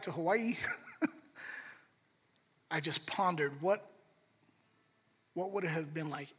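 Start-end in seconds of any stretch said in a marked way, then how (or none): none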